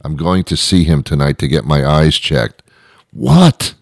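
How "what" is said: high squeaky what of disbelief. Pretend. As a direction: react in total disbelief. Mock disbelief reaction.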